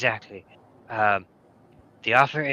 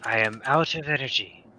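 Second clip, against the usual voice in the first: ordinary talk, nothing marked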